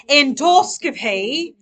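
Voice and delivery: loud, drawn-out British "Endoscopy", last syllable as "pay"; mock British accent. Shown as a demonstration. Teacher modelling a term for students, in an exaggerated British accent. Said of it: "say it in a British accent".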